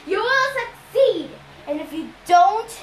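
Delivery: high, loud menace; loud threat. A narrator's reading. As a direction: threaten her loudly. Ares threatening daughter.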